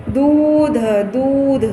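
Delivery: दूध with the oo held long, twice; long vowel. Shown as a demonstration. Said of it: "ऐसे बोल सकते हैं".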